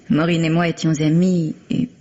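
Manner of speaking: ordinary talk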